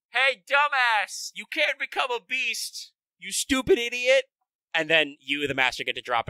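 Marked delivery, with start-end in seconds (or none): 0.1-4.3 high, slow, mocking insult; scornful Abe no Seimei. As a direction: drag out the scorn, pitched high. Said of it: "Abe no Seimei is like".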